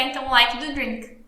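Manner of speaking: ordinary delivery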